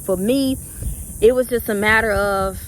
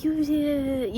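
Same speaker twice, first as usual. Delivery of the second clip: other side of inner debate; 7 semitones higher, 4 dB quieter than usual. Retold or imitated. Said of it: "I went back and forth with myself".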